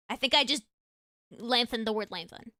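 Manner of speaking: ordinary delivery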